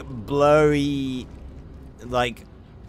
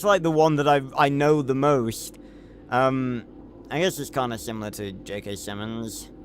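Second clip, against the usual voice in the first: ordinary delivery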